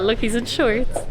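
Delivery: usual speaking voice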